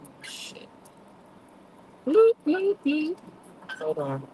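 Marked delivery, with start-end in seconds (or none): 2.1-3.2 high-pitched little bloops; three quick rising bloops; high bloop noises. Shown as a demonstration. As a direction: pop out bloop sounds up high. Imitated noise while off on own.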